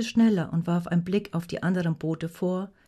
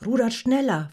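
raised, forceful order; louder, higher command; urgent demand; 5 semitones higher, 5 dB louder than usual. A narrator's reading. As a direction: drive the rowers on. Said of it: "forderte Rognet".

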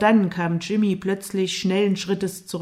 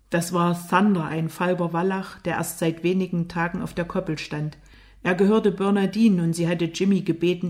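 usual speaking voice

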